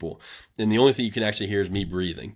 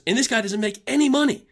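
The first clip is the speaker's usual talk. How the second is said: high, ranting mock coach; mimicking angry coach. Coach ranting at the team about the unpaid intern, high mock anger. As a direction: rant like the pissed-off coach, pitched high. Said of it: "And he goes".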